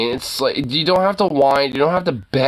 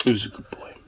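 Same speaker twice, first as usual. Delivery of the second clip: low, affectionate pet talk; cooing to dog; 5 semitones lower, faster than usual. Real, not acted. Owner tells dog he's good, low cooing.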